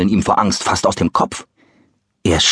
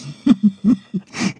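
put-on high delighted old man's chuckle; pleased chuckle; 11 semitones higher than usual. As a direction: chuckle happily to yourself. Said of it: "brummelte vor sich hin".

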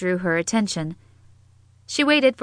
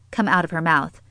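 plain reading voice

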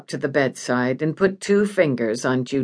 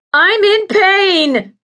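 drawn-out high, loud scream of pain; agonized patient's shriek; 7 dB louder, slower than usual. Acted. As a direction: scream it slowly at full pitch. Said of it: "Judy screamed".

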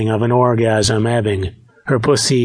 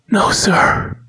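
high, airy, submissive heroine; half-whispered high reply; breathless; 9 semitones higher than usual. A narrator's reading. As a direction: answer obediently on a raised, breathy voice. Breathless heroine answers the instructor.

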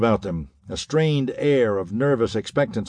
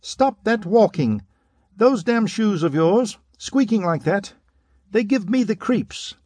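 much higher, slow, strained complaint; high-pitched outburst; 11 semitones higher than usual. A narrator's reading. Pudgy man snapping at the pacing tall man, high-pitched and slow. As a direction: squeal it like a man whose nerves are frayed. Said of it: "he broke out in a high-pitched voice".

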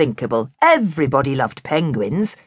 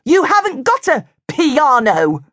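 yelling; 8 semitones higher, 3 dB louder, slower than usual. A narrator's reading. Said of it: "he yelled".

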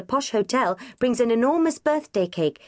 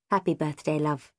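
tender mum; 3 semitones lower than usual. A narrator's reading. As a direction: say it gently to your daughter. Mum greeting daughter tenderly.

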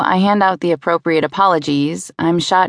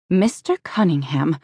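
annoyed sigh. A narrator's reading. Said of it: "she sighs with annoyance".

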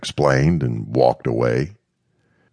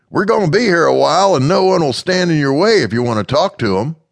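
fast, higher, louder; encouraging Mister Smith; 13 semitones higher, 5 dB louder, faster than usual. Acted. Mister Smith urging Amanda on, fast and higher. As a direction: rush the encouragement, voiced high.

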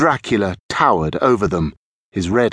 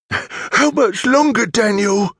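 put-on high, gruff young thief; gruff, high-pitched character voice; 12 semitones higher than usual. A narrator's reading. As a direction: ask it gruffly, pitched well up. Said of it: "in a gruff voice".

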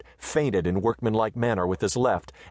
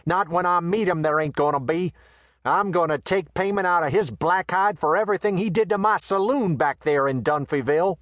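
a swaggering Western tough, raised high; brash gunman voice; 9 semitones higher than usual. A narrator's reading. Quay threatens McTee.

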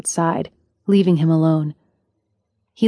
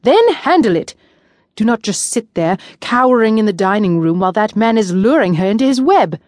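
angry outburst; 3 semitones higher, 3 dB louder than usual. A narrator's reading. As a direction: explode at him, then keep scolding. Ari berates the cowering prince.

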